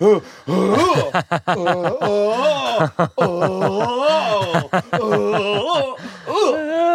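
imitating mom's choking gasps, high; mock choking oh's as she's squeezed; Heimlich grunts. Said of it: "noises I've never heard from my mother".

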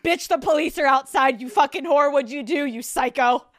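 loud, abusive mock American; brash American. American waiter announces the police, brash mock abuse. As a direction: lay into them as a brash American. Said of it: "An American would be like".

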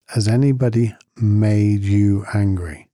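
slow, probing teacher question; a hint of a patient teacher pressing the question. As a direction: lean in and ask it slowly. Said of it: "And he leans in".

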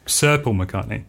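slow correction, weight on "Sir"; stress on SIR. Real, not acted. Guest correcting the host on a missing title, stress on SIR. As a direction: lean on "Sir" as a pointed correction.

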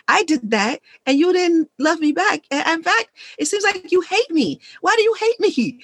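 mock hurt husband, pitch rising; husband's hurt complaint. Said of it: "the contract was".

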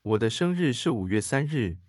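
ordinary delivery

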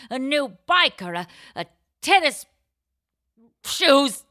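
high, loud, escalating demands; wheedling demands that keep building; whiny kid. Pretend. List of kids' demands, escalating.

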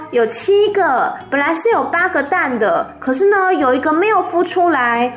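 put-on high, bright-eyed little girl voice; little Fern chattering to her parents, high and eager. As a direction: tell it like an eager child reporting news. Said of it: "芬就说".

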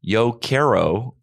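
slow, clumsy gringo Spanish; American-accented Spanish. Shown as a demonstration. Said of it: "versus if you come to".

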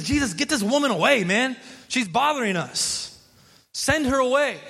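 a touch of fed-up grumbling; annoyed disciples. Retold or imitated. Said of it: "they were annoyed".

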